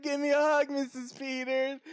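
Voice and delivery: high, gushing affectionate greeting; sweetest guy. Retold or imitated. Imitating coworker hugging an old customer, sweetly gushing. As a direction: coo it to a beloved regular. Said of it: "the sweetest fucking guy".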